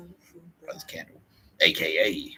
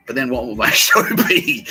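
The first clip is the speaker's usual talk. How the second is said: laughing; 9 semitones higher, 11 dB louder than usual. Real, not acted. Laughing at own joke.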